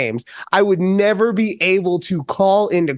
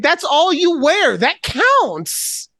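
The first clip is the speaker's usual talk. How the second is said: high-pitched exasperated comeback; retold indignant protest; 9 semitones higher, much slower than usual. Arguing over a sports bra, indignant. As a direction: argue back, voice jumping up.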